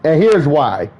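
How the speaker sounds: ordinary delivery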